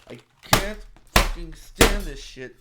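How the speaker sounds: slow, loud, seething roar; furious outburst. Real, not acted. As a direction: let the anger explode on every word. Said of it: "I'm sorry that was so loud".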